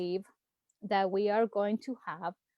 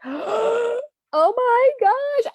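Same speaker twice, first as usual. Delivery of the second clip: high, slow, drawn-out squeal of delight; thrilled gasp; 14 semitones higher, 8 dB louder, much slower than usual. Retold or imitated. Vlogger reliving the VP news, squealing with delight. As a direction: gasp then stretch it out in joy. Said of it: "I was like".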